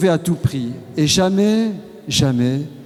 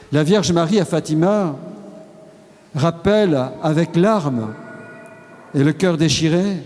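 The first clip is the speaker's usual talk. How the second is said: ordinary talk, nothing marked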